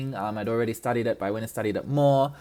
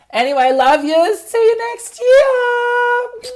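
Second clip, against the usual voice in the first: sing-song goodbye; 10 dB louder, slower than usual. Real, not acted. Sign-off to listeners.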